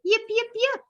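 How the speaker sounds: high, bright yipping like a little fox; fox calling. A narrator's reading. Reading a picture book to kids, little fox calls out high. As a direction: call out yips up high. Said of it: "she called".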